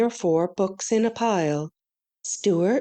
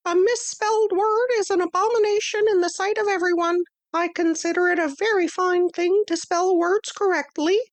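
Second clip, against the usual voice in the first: much higher, quick and crisp; a tiny teacher calling the class to order; high little mouse-teacher voice; small Stuart voice; 11 semitones higher than usual. Acted. Stuart lectures on spelling.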